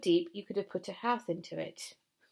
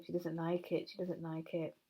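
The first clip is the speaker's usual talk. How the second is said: ordinary delivery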